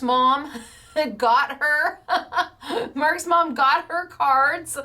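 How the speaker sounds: slow, broken by real laughter; laughing. Real, not acted. Crafter laughing while telling viewers about Mark's mom. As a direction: laugh as you try to say it.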